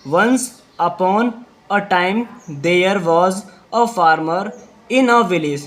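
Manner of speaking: very slow, word by word for repeating; slow dictation. Shown as a demonstration. Teacher dictating a line for pupils, very slow. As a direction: spell it out slowly so children can echo. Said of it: "तो साफ साथ आपको repeat करना है".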